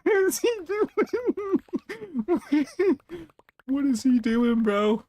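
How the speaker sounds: high squeals of laughter between questions; can't stop laughing at him; high-pitched laughing disbelief. Real, not acted. Villager farming wildly.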